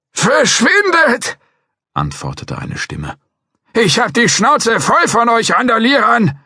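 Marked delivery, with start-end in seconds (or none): 0.1-1.4 put-on high, slow old man yelling off; old man's voice. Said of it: "Eine Greisenstimme". 3.7-6.4 put-on high, loud cranky old man; a fed-up old geezer shouting at rowdies; old man's voice. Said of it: "Eine Greisenstimme".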